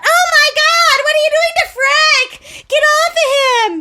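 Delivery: high, loud horrified scolding; shrieking mom. Retold or imitated. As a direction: shriek at the kids to get off. Mom shocked, scolding kids.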